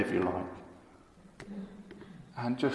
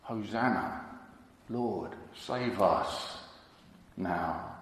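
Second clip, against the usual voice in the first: slow, low, soft plea; hushed cry; 3 semitones lower, 6 dB quieter, slower than usual. Retold or imitated. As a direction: let the crowd's cry come out hushed. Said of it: "the crowd cried out".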